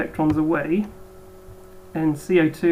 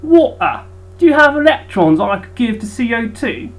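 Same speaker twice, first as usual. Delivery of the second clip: hammed-up bad Cockney, pitched higher and louder; a schoolboy's bad Cockney impression, raised and loud; bad Cockney accent; 5 semitones higher, 8 dB louder than usual. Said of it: "bad impersonation of a Cockney accent".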